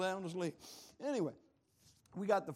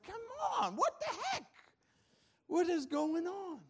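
frustrated outburst; 13 semitones higher, 4 dB louder than usual. Acted. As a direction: cry out as if fed up with everything. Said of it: "ever get frustrated and you're like".

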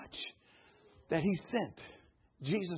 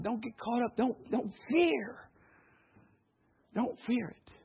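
reassuring; 6 dB quieter than usual. Real, not acted. Pastor soothing after loud part.

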